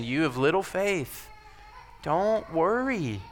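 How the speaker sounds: Jesus comforting worried disciples; gentle comfort. Acted. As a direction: soothe them gently. Repeating Jesus' phrase.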